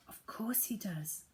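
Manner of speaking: hushed whispered agreement. Acted. Humphrey whispering so the prince won't hear. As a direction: keep it secret, breathe the words. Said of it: "agreed Humphrey".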